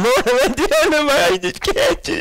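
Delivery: high, strained mock whine; hammed-up whimper of giving up; mock despairing whine. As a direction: whine it like you're at the very end. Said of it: "Have you ever been there".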